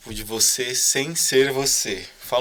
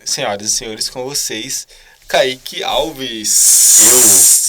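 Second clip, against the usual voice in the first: drawn-out announcer call, much louder; grand entrance announcement; 10 dB louder, much slower than usual. Acted. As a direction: stretch out the guest's name like a ring announcer. Host introduces guest on podcast.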